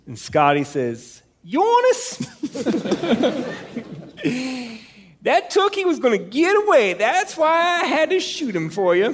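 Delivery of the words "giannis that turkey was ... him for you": high, thick Down East twang; Scotty's Maine accent. Retold or imitated. Friend retelling the hunt, doing Scotty's Maine accent. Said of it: "without doing his voice".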